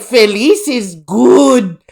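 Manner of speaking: slow, loud mock Mexican accent; hammed-up Mexican accent, vowels stretched; exaggerated Spanish. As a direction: imitate a Mexican cartoon iguana explaining. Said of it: "she fucking exaggerates it".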